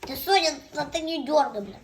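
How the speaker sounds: loud scolding. Real, not acted. Scolding child loudly.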